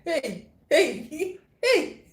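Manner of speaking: high squealing "hey"; mimicking husband, voice squeaking high; laughing quote. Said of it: "he's like".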